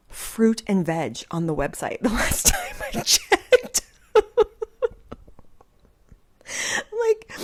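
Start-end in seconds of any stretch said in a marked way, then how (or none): 4.1-5.1 high, loud cackle; loud burst of laughter. Real, not acted. Vlogger mocking the video, loud laugh. 6.9-7.3 laughing. Real, not acted. Laughing still.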